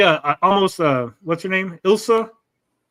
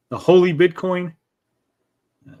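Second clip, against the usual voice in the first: ordinary talk, nothing marked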